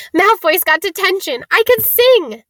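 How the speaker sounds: high, loud, jubilant squeal; giddy triumphant outburst; gleeful celebration. A narrator's reading. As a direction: crow with delight, voice soaring. Hermione rejoicing, doing a jig.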